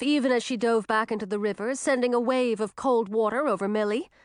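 usual speaking voice

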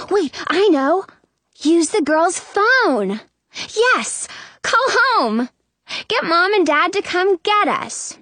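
high, eager burst of realization; light up as the plan comes together; sudden excited idea. A narrator's reading. Abby remembers the plan to call home, excited.

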